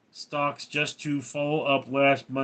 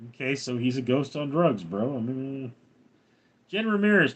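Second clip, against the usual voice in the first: ordinary talk, nothing marked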